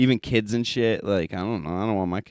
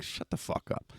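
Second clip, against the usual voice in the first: breathy, much softer throwaway; whispered dismissal; 11 dB quieter than usual. Retold or imitated. Dismissing wannabe tough guys under his breath, whispered. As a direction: drop to a whisper and brush them off. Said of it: "I'm like".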